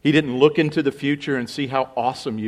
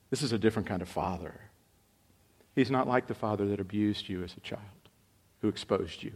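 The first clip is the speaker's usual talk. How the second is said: soft, low, gentle promise; tender reassurance; 4 semitones lower, 8 dB quieter than usual. Acted. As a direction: comfort the wounded listener quietly. Voicing John's tender assurance.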